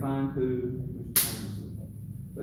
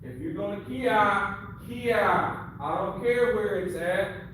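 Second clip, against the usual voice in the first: high, raised coaching voice; calling out instructions; 8 semitones higher, 4 dB louder than usual. Real, not acted. Sensei urging students to kiai, raised commanding voice. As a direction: project the correction across the class.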